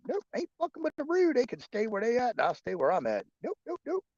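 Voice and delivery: backing off from the kangaroo, high-pitched; scared zookeeper. Retold or imitated. Zookeeper refusing to mess with the kangaroo, high panicky.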